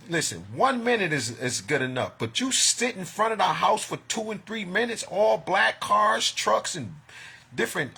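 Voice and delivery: worked up over the cars; agitated. Real, not acted. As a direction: hammer home each detail. Agitated retelling of cars outside.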